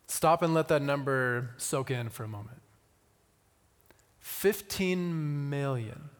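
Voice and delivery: ordinary delivery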